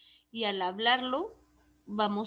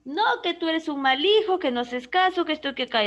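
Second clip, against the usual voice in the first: loud, higher scolding shout; mother yelling; 5 semitones higher, 8 dB louder than usual. Retold or imitated. Teacher acts out a mother yelling at her son. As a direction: scold the child like an angry mother. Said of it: "Karina y grita".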